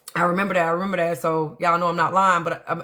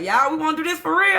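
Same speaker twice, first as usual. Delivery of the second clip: excited question; 12 semitones higher, 3 dB louder, faster than usual. Retold or imitated. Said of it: "I was like".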